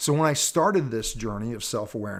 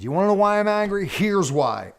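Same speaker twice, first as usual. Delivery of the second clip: retold angry outburst, higher, louder and fast; exploding in anger; 7 semitones higher, 4 dB louder than usual. Man on the hot seat exploding at the circle of questioners, angry outburst. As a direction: burst out at them, half reliving it. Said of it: "Till I got angry and exploded".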